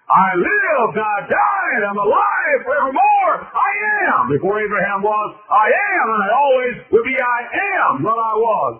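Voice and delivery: high, loud, sustained proclamation; full-force preaching. Acted. Preacher voices the living Jesus, ringing sermon shout. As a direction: cry it out triumphantly.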